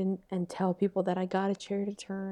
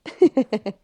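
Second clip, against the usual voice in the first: laughing; 2 semitones higher, 10 dB louder than usual. Real, not acted. Laughs at the imitation story.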